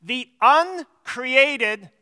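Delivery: "uncreated" drawn out slowly and firmly; emphatic repeat. Shown as a demonstration. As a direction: spell out "uncreated" with slow, deliberate weight. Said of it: "I want to emphasize that word".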